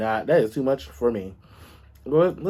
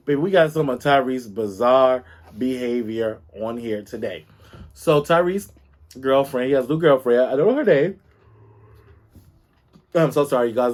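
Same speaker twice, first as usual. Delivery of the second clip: normal talking voice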